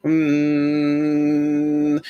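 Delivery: long steady monotone hum; low humming. Shown as a demonstration. Demonstrating the realm's noise to viewers, droning hum. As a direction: hum one droning note, mouth closed.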